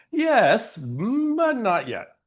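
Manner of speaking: high, drawn-out reluctant agreement; hesitant yes. Acted. Speaker voicing people who put off joy, mock hesitant. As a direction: stretch it out, acting out a stalling yes.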